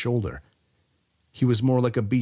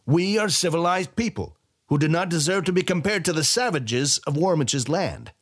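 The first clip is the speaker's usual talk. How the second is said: much higher, scornful commander, slowing at the end; haughty general; 9 semitones higher than usual. A narrator's reading. General scorning Warmich's people, high haughty voice. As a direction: sneer at the savages.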